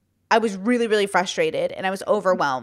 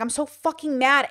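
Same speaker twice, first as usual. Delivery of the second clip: retold anger; 5 semitones higher than usual. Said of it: "And I was like".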